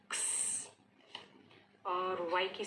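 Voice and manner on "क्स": क्स as a whispered K and S together; X sound. Shown as a demonstration. Teacher breathes out क्स for students. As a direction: hiss क्स softly. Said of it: "क और स एक साथ".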